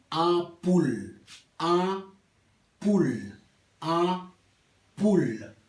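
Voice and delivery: "Ampoule" split slowly, nasal "an" then "pool"; ampoule en criollo. Shown as a demonstration. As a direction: sound out "Ampoule" slowly in two syllables. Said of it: "para pronunciar". ("Ampoule" despacio, "an-pul", con A nasal)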